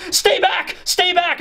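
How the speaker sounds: high, frightened shout of stay back; scared warning. Retold or imitated. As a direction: warn him off in a high, scared voice. Women hold swords up to Sanji.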